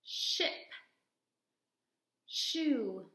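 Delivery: each word said clearly, pausing for an echo; call and response words. Shown as a demonstration. Teacher leading kids to repeat sh words. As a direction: model the word, then wait for the child. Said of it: "I say, you say".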